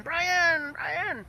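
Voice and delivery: high-pitched calling out, not full volume; imitated distant scream. Guys in town screaming his name as we drive, high mock yell. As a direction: mimic someone yelling a name from afar. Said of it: "guys screaming".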